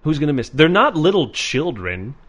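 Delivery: usual speaking voice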